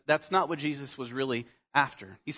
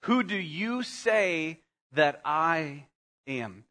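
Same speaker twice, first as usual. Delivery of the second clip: solemn, drawn-out question; slow weighty question; much slower than usual. Shown as a demonstration. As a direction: let the question hang slowly. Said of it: "This is like the moment".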